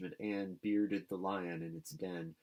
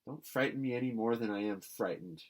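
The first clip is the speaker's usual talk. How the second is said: usual speaking voice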